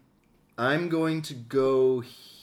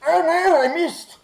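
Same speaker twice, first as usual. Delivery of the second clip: high, loud mock wail; dismayed cry; 11 dB louder than usual. Player whose attack missed, high dismayed exclamation.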